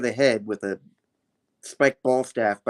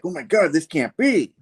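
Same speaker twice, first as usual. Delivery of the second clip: mock disbelief, pitched up; Paul; 6 semitones higher than usual. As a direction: act out a higher-voiced dismayed reaction. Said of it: "And Paul's like".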